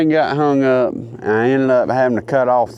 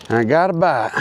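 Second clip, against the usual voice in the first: ordinary talk, nothing marked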